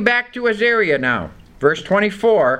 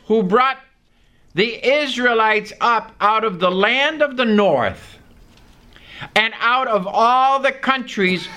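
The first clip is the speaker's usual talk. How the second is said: a slightly raised, measured Bible reading; scripture reading; 4 semitones higher than usual. Acted. Preacher reading Jeremiah to the congregation, measured and raised. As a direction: read the verse out to the congregation.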